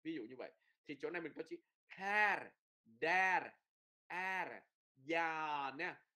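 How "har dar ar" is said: "her, der, er" all with open "a": har, dar, ar; emphatic long "a" in "her, der, er"; E đọc như A. Shown as a demonstration. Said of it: "đọc như là chữ A". ("her, der, er" đọc âm A)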